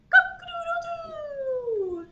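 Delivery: very high, slow, drawn-out crow; long rooster crow. Pretend. Storyteller repeating the rooster for toddlers, long high crow.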